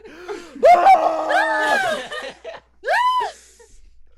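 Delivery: high loud shriek of laughter. Real, not acted. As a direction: burst into a screaming laugh. Big laugh at smash cut.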